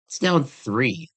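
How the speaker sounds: DOWN THREE said slowly and firmly, correcting "down one"; slow, deliberate correction of a slip; corrective stress. Real, not acted. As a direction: stress "three" to fix the mistake. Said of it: "whoops, did I say down one?".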